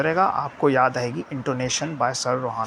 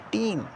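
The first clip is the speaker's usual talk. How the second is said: टीन said alone on one normal, unchanging tone; stationary tone on टीन; 4 semitones higher, 5 dB quieter, slower than usual. Shown as a demonstration. Teacher showing students a stationary tone on टीन. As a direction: keep टीन neither raised nor dropped. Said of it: "normal टोनेशन में बिल्कुल stationery है".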